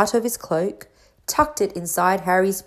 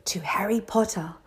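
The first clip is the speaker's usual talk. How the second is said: hushed whisper. A narrator's reading. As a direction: whisper the toast like a secret. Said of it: "saying in hushed voices".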